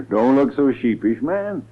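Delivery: normal talking voice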